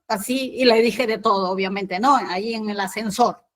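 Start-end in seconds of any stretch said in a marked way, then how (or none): none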